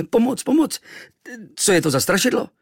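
very high, loud frightened cry; blurted terror. A narrator's reading. Boy waking to a looming stranger, terrified. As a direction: call for help in panic. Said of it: "Vyrazil jsem ze sebe".

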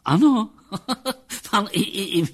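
high, loud amused voice breaking into laughter; laughing psychologist. Acted. Psychologist laughing at the captain's realisation, amused and high. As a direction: chuckle through the words, pitched well up. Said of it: "Psychologův smích vytryskl".

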